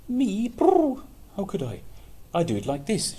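high, loud birdlike voice; high dove voice. Acted. Dove answering the mole, high chirpy voice. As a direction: chirp it like a little bird. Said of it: "she answered".

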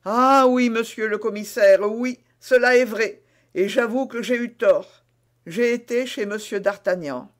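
contrite, eager-to-please suspect; Bonacieux qui avoue. Acted. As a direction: start loud on Ah oui, then settle. Voices Bonacieux confessing. (aveu empressé et contrit)

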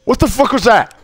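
usual speaking voice

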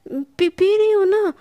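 high, loud flustered burst; flustered outburst. A narrator's reading. Sana caving in to Palak's threat, flustered and loud. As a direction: blurt it out all at once, rattled.

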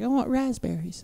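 put-on high, soft, drawn-out toddler plea; softly piped cute-kid imitation; cute little girl. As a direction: imitate a tiny kid asking sweetly. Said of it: "she'd do it all cute".

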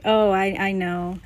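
usual speaking voice

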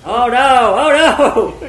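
laughing, loud, high panic; excited alarm. Real, not acted. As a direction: yelp it out half laughing. Crashing into tornado in game.

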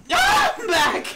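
high, loud cartoon yelp; bubbly game girl. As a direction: pop up with a high, loud squeal. Acted startled character returning.

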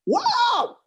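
high, drawn-out wow; amazed high wow; awed wow. Real, not acted. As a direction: gasp it up high in awe. Awed exclamation in sermon.

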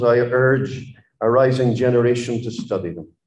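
ordinary talk, nothing marked

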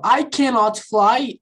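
slow, careful I cannot fly; slow full form. Shown as a demonstration. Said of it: "eu peguei o not".

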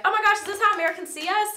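put-on high, loud, quick British accent; mock British accent. Imitating UK viewers reacting to her impression, British accent. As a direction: imitate amused Brits in their accent. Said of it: "whenever I throw on a British accent".

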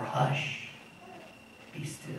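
put-on quiet the sea like soothing a child; a gentle hush, almost inaudible; soft hush.

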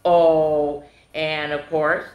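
ordinary delivery, nothing marked

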